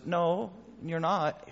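calm unbending God. Pretend. God's calm reply to rebellion.

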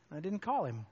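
ordinary talk

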